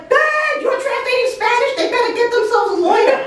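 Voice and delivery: high-pitched, rapid family jeering; mocking relatives. Family hears she's translating Spanish, high-pitched mocking cry. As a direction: imitate relatives crying out to Dad. Family reacting in retold phone call.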